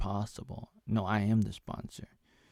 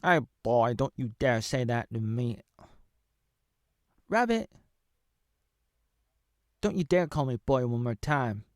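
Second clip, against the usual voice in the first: acted anger; 4 semitones higher, 4 dB louder than usual. Acted quarrel with the rabbit.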